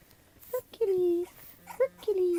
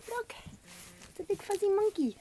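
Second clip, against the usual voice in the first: puppy talk; 11 semitones higher than usual. Real, not acted. Owner showing a toy to puppies, high voice. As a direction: coo it up high.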